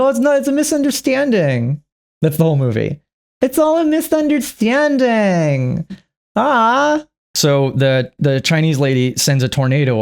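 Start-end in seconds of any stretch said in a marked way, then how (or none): 0.0-1.8 mocking voice. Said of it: "You're like". 3.4-7.0 much higher, slow, sarcastic drawl; mocking singsong. Mocking the movie's plot, a high, slow, sarcastic drawl.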